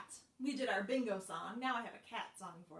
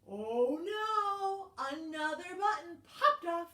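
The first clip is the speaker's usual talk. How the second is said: higher, louder alarm; 6 semitones higher, 7 dB louder, slower than usual. Acted. Teacher reacting as another button pops. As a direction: gasp out the bad news.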